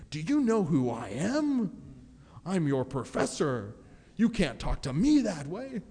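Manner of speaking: hammed-up offended professor; mock wounded pride. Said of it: "temptations came up to say".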